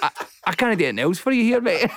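high and fast, defeated; retold giving up. Comedian giving up on the doubting fan, exasperated. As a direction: rush it out in helpless surrender.

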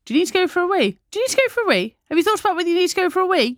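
high, sing-song parent nag, fast at the end; nagging parent voice. Retold or imitated. Mum nagging a toddler about the toilet, high sing-song. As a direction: mimic a mum pestering her child about the toilet.

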